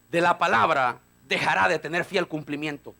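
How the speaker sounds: ordinary delivery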